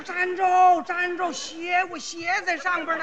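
边追边高声喊车站住; 冲开走的汽车急喊; 追车喊. Retold or imitated. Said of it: "我说". (追着汽车大喊站住)

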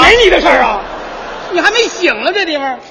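loud, sharp dubbed outburst; a heroine yelling in a film dub; angry shout. Acted. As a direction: shout down the meddler. Dubbed heroine snaps at bystander.

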